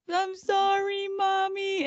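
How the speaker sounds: high, loud mock baby voice; teen aping a small child's apology; mimicking little kid. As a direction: sing out a fake childish sorry. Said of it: "he's trying to act like the little kid".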